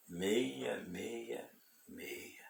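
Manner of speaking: very slow, soft whisper; hushed whisper. Real, not acted. Preacher reveals who it is to listeners, slow whisper. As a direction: whisper each word slowly, with weight.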